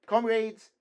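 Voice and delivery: sudden high shout to the crowd; young student shouting from the window; sudden cry. A narrator's reading. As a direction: yell out to your comrades. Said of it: "suddenly cried one of the young fellows".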